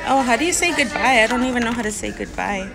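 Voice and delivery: normal talking voice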